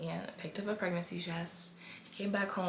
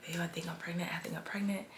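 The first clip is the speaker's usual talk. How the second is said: whispering; 6 dB quieter, faster than usual. Retold or imitated. Whispered retelling of telling husband.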